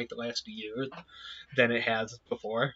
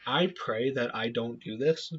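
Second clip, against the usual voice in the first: normal talking voice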